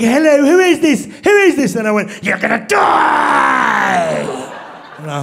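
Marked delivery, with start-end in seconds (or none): 0.0-1.8 high, loud, alarmed British voice; mock panicked Brit demanding an answer; British accent. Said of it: "British, I knew it". 2.2-4.2 very slow, drawn-out monster shout; menacing prank threat. Acted.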